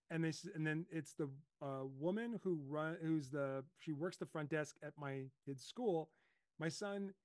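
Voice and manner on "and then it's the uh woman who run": ordinary delivery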